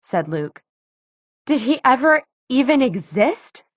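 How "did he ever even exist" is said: higher, climbing question; voice rising. A narrator's reading. Clary questions whether the man existed, voice rising. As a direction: let the pitch climb as you demand an answer. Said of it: "Clary's voice rose".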